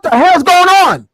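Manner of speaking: high loud mock outrage; mock shocked. Mocking a limp handshake with high shocked yell. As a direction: yelp it out in fake shock. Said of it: "shaking their hands like".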